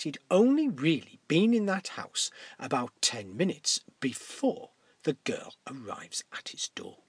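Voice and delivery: ordinary delivery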